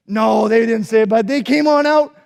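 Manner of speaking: usual speaking voice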